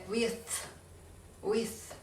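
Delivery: "with" ending in th, tongue between teeth; blown th. Shown as a demonstration. Teacher demonstrating th to viewers, airy final sound. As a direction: blow air through the th of "with".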